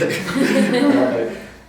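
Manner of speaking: high burst of laughter. Real, not acted. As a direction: laugh at your own joke. Amused by his own joke.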